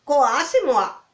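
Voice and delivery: toad voice. Acted. Toad answers princess.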